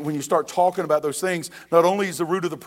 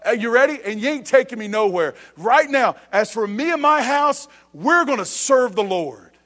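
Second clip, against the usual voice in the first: emphatic declaration; 3 semitones higher, 3 dB louder than usual. Acted. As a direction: declare it like driving a stake down. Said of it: "almost like drives a stake down".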